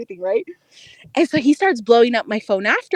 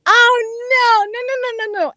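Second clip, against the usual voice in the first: retold dismay; 8 semitones higher than usual. Telling friends about seeing his basement, dismayed. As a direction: replay your dismay in an exaggerated groaning plea.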